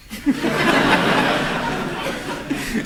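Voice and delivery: high, breathless burst of real laughter. Real, not acted. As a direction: crack up at the memory. Laughs recalling singing prime minister.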